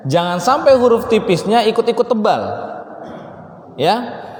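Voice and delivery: ordinary talk, nothing marked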